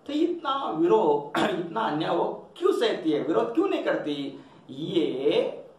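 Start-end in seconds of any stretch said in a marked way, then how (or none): none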